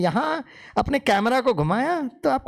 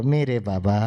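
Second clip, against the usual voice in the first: with love; 11 semitones lower than usual. Shown as a demonstration. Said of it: "थोड़ा प्यार मोहब्बत से बोलो".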